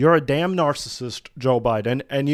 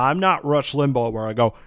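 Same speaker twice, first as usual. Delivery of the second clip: usual speaking voice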